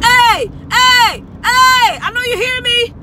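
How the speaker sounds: high loud calling out; shouted hey, hey. Retold or imitated. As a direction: yell to get attention across the bar. Somebody calling out, acted.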